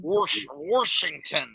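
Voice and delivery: Washington said slow with an extra hard R, "Warshington"; mock local Washington with an added R; hard R demo. Shown as a demonstration. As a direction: drag out Washington with a hard R slipped in. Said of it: "Hard R's on everything".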